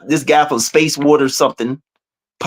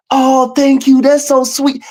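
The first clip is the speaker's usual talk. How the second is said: higher-pitched imitation of a gushing AI; emotional AI voice; 6 semitones higher, slower than usual. Quoting the AI's thanks on a live call, emotional. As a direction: mimic her feeling and cadence, pitched up. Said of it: "you hear that emotion, that cadence".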